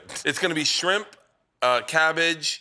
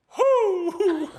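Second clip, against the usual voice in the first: high loud hooting call; owl hoot call; 4 dB louder than usual. Retold or imitated. Dad calling to the owl off the dock, a high hoot. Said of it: "he's like".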